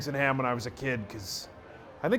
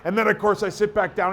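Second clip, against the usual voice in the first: normal talking voice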